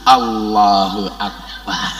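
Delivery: slow, low, soothing croon; pleasing, pretty-girl intonation. Shown as a demonstration. Preacher showing a pleasant takbir on seeing a beauty, slow croon. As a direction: draw it out slowly and sweetly. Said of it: "intonasinya dibuat menyenangkan".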